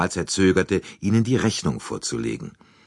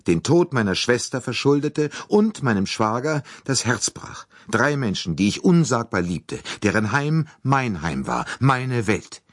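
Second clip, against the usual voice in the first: higher, impassioned woman in grief; passionate agitation; 7 semitones higher than usual. A narrator's reading. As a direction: plead it with rising passion. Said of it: "Ihre Stimme bebte vor leidenschaftlicher Erregung". (leidenschaftlich erregt)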